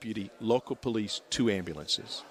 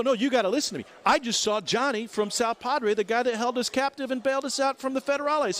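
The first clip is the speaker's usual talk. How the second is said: higher, eager, rushed insistence; excited retelling; 10 semitones higher than usual. Retold or imitated. Telling his buddy he just saw Johnny. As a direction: burst with the news. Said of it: "I go".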